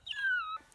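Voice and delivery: quick high burst of laughter; a light giggle at herself; short laugh. Real, not acted. Vlogger signing off to viewers, short high laugh.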